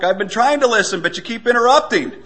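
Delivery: loud, high irritated retort; yelling back. Retold or imitated. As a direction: yell back as the interrupted wife. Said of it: "She yells back".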